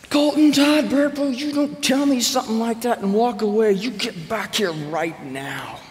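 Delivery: high, loud furious yell at her son; mother's scream. Retold or imitated. Mom yelling at her son who walked away, furious. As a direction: scream his full name like an enraged mom. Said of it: "She stood up and screamed at Colton".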